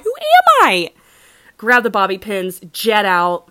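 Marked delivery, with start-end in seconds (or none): 0.0-0.9 a far higher, louder squealing repeat; mock disbelief peaking. Stealing bobby pins, shrieking at herself in mock horror. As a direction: push the same question up into a shriek. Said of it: "I'm like".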